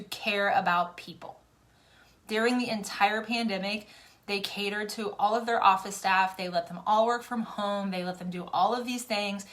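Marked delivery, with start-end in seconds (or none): none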